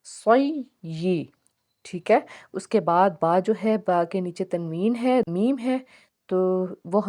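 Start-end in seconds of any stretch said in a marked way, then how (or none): 0.0-1.2 سوئی with the ی held double and drawn very slow; doubled ya. Shown as a demonstration. Teacher showing students the shadda on ya. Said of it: "یا دو بار پڑھا جائے گا".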